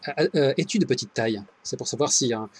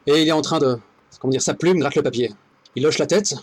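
ordinary delivery

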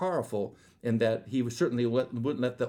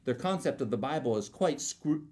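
ordinary talk, nothing marked

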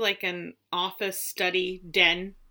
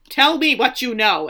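eager demanding queen; 4 semitones higher, 8 dB louder than usual. Acted. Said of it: "and she's like".

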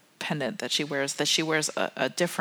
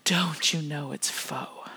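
icy put-down; 3 dB quieter, much slower than usual. Retold or imitated. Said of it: "giving them the cold look and saying".

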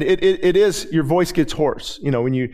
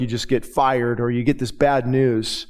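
ordinary talk

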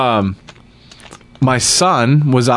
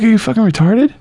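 incredulous; 9 semitones higher than usual. Retold or imitated. Incredulous retort.

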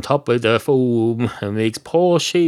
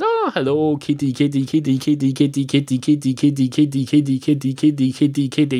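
higher, rapid repeated kitty-call; coaxing a cat; 4 semitones higher than usual. Acted. Man greeting a cat with kitty kitty, coaxing. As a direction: call a little cat over, pitched up.

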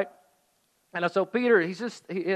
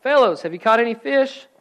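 raised, louder call; 5 semitones higher, 8 dB louder than usual. A narrator's reading. Jesus on the beach calling to fishermen, calling out. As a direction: call across the water. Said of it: "He called out".

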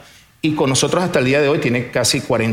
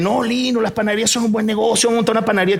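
friend urging; 8 semitones higher than usual. Retold or imitated. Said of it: "Y él".

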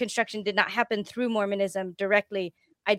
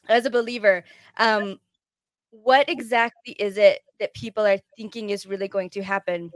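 usual speaking voice

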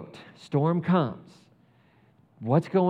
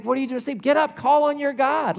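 higher, louder urgent captain demanding he wake; the captain urging Jonah up, high and pressing; alarmed captain; 10 semitones higher, 5 dB louder than usual. Retold or imitated. Preacher voicing the ship captain waking Jonah, higher and louder. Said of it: "He's like".

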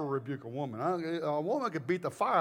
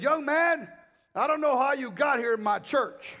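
high, loud, indignant warning; stern pastor; 7 semitones higher, 5 dB louder than usual. Retold or imitated. Old pastor introducing a guest he distrusts, stern and loud. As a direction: challenge the guest loudly. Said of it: "He introduced me this way".

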